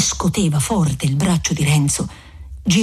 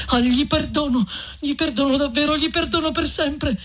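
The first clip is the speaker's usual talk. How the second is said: put-on high, urgent young man's plea; fervent exclamation; 11 semitones higher than usual. A narrator's reading. Renzo urgently forgiving to win back the friar, high and fervent. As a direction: insist on it fervently, pitched high. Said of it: "esclamò il giovine".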